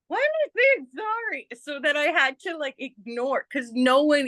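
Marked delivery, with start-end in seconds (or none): 0.1-1.5 high, baffled squeal of self-questioning; mock bewildered. Retelling her confusion on the subway, mock bewildered. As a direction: ask yourself in high-pitched disbelief.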